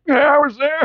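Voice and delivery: put-on high, creaky elderly voice; old person voice. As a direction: play someone in their eighties. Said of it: "Somebody in their eighties right now going like".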